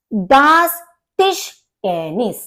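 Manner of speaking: टिश said as "tish" with crisp sh; German word demo. Shown as a demonstration. Teacher shows students the German table tennis. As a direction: model दास टिश टेनिस carefully. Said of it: "table को German में कहते हैं टिश".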